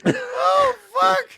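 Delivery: high cry at the end of a laughing fit; worn out laughing. Real, not acted. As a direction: gasp it out, helpless with laughter.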